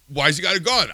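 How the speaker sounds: exasperated demand. Real, not acted. Ranting podcaster demanding answers, exasperated and loud. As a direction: fire the question off in disbelief.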